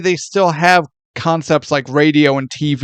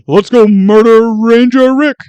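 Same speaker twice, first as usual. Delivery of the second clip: loud, higher cartoon bear voice; goofy cartoon bear, louder; vintage cartoon voice; 6 semitones higher, 7 dB louder than usual. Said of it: "It's the same voice".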